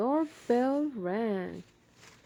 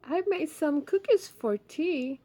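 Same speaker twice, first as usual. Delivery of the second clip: Ma's voice; 6 semitones higher, 3 dB louder than usual. Acted. Ma voiced in picture book.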